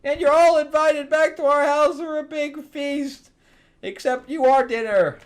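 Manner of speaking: very high, loud cheering shout; triumphant cheer. Retold or imitated. Missus Claus inviting everyone to the feast, high loud cheer. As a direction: cheer it like a giddy hostess. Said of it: "Missus Claus is like".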